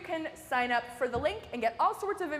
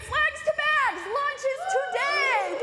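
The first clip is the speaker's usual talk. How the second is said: excited announcement; 12 semitones higher, 5 dB louder, slower than usual. Real, not acted.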